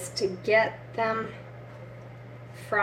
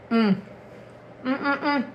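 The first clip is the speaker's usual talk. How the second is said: savoring mm; 3 semitones higher, 3 dB quieter, faster than usual. Real, not acted. Eating, loving the taste.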